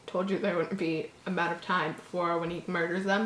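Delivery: plain reading voice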